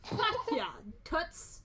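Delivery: high, loud, cocky challenge; smirking. Acted. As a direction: throw it at them with a smirk. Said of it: "like, a little smirk".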